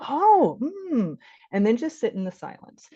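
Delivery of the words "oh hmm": high, swooping sounds of concern; interested acknowledgement. Shown as a demonstration. Parent acknowledging a child's feelings, sympathetically interested. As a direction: let the listening sounds rise and fall.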